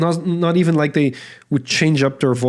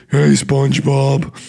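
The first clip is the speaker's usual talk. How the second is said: Patrick; slower than usual. Pretend. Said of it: "that's basically Patrick".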